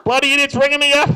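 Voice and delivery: loud, high-pitched flustered phone yell; hammed-up irate elderly dad; dad brushing off caller. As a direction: shout down a cold caller. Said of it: "My dad thinks it's a call centre".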